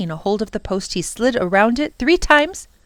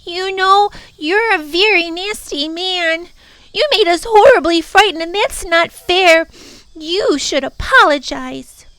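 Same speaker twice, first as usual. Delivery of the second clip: put-on high, loud, indignant girl; angry; 11 semitones higher, 7 dB louder than usual. Lindy telling off the Prock, high and angry. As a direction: scold him like a furious child. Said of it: "said in an angry voice".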